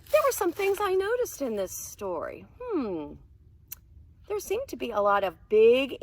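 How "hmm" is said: ordinary talk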